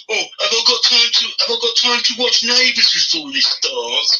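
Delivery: high mocking whiny voice; sarcastic high-pitched mimicry of a viewer; mocking impression. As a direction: mimic him as a lazy whiner. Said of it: "He's like".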